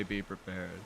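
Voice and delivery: soft, lightly British villain voice; hint of a scheming Disney villain, British accent. Theater kid quoting a Disney villain, soft British accent. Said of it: "a Disney villain when I say".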